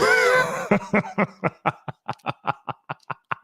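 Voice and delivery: drawn-out hearty laugh; a real amused laugh at the chat; long real laugh. Real, not acted. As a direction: laugh it off at length.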